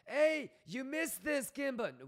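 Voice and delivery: high, slow, drawn-out mock whine; hammed-up nervous whimper; whiny mock panic. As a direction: imitate a panicking viewer pestering the streamer. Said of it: "I get people that ... are freaking out".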